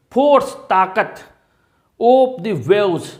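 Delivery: slow dictation. Shown as a demonstration. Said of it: "teacher dictates phrase slowly".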